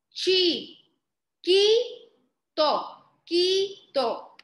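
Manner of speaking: high teacherly beats on "Chi-, quito"; syllable by syllable. Shown as a demonstration. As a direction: sound out "quito" as KI, pause, TO. Teacher sounds out word by syllables.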